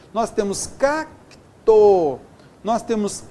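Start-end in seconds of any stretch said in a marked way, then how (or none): 0.7-2.2 "cacto" broken slowly as "cac... to"; teacher separating "cac" from "to" in "cacto"; syllables split apart. Shown as a demonstration. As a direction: split "cacto" into two drawn-out beats. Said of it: "ficam em sílabas diferentes".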